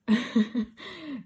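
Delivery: real laugh, a little embarrassed; short laugh. Real, not acted. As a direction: laugh off the sentimental moment. Embarrassed laugh after sentimental admission.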